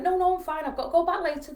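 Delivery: loud, high-pitched denial; acted false reassurance. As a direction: insist all is fine to cover a lie. Lying to suspicious daughter.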